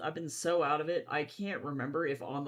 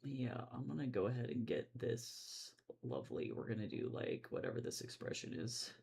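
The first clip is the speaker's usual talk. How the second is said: muttered; 6 semitones lower, 12 dB quieter than usual. Real, not acted. Muttering to self while drawing.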